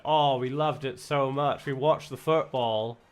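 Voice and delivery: a clumsy attempt at drunk American tourists, drawn out; bad American accent. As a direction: drunk American tourists telling the bar staff about their night, in a bad American accent. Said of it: "probably the worst American accent ever".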